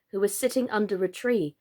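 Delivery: plain reading voice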